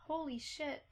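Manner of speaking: soft, stunned exclamation; hushed amazement. Real, not acted. Streamer absorbing a viewer's generous gift, hushed amazement. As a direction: say it softly, taken aback.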